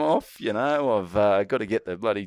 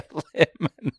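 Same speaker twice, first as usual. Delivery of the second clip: laughed-out words, pitched higher; laughing; 5 semitones higher than usual. Real, not acted. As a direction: say it while really cracking up. Cracking up at forgotten word.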